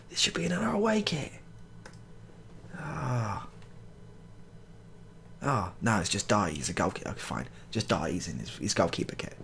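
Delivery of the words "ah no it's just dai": ordinary delivery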